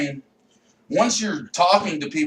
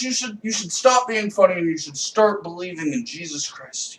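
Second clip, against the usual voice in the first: normal talking voice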